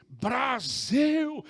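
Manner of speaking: loud, high, drawn-out cheer; delighted exclamation. Retold or imitated. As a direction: imitate an official's thrilled cry. Imitates Chinese officer exclaiming at passport. (grito empolgado imitado)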